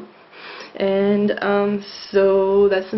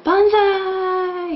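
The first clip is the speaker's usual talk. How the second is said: high, slow, drawn-out "banzai" cheer; stretched, joyful high-pitched banzai; happy cheer; 13 semitones higher, 4 dB louder than usual. Acted. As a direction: cheer it out like a delighted cartoon character. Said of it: "to show how happy he is".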